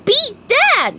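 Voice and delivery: high, loud, gleeful SpongeBob shout; SpongeBob's bouncy cartoon yell; happy yell. A narrator's reading. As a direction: crow it out with joy. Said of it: "yelled SpongeBob happily".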